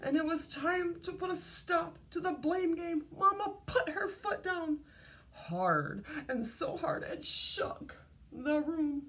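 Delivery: plain reading voice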